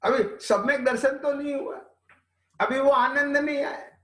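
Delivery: lightly mock the eager student; mock disciple.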